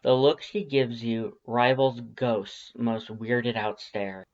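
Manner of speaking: plain reading voice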